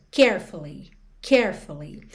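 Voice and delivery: "carefully" hit on CARE; "carefully" with a strong first syllable; stress on first syllable. Shown as a demonstration. Teacher stressing CARE in "carefully". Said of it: "a sílaba tônica é a primeira".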